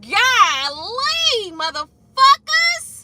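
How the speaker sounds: high, drawn-out exasperated golly; high, slow tired groan of complaint; mock exasperation. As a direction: stretch it out, fed up. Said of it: "be like".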